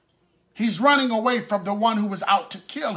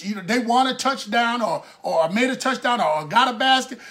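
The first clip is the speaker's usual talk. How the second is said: normal talking voice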